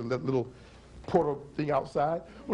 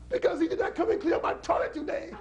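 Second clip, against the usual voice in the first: wailing cry. Acted. Punchline to soldiers.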